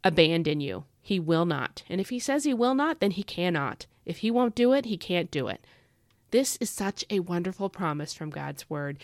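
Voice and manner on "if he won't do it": normal talking voice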